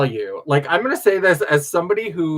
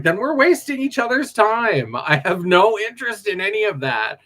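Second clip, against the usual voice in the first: snippy; 7 semitones higher, 3 dB louder than usual. Retold or imitated. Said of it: "got a little bit snippy with that one guy".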